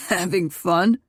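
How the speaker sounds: lightly voiced mocking brother; amused teasing. A narrator's reading. Brother catching the couple from the doorway, amused. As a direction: tease them, amused. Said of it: "Mason laughed".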